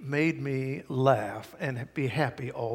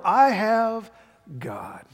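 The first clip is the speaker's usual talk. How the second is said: slow, emphatic proclamation; preaching declaration; 4 semitones higher, 4 dB louder, much slower than usual. Real, not acted. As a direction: declare it slowly with full conviction. Leads a declaration.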